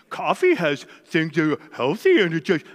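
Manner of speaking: low, muddled mock voice, stumbling over the words; mocking health-food excuse. Mimicking people who defend chocolate as healthy, mocking low voice. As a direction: parody a dopey know-it-all making excuses.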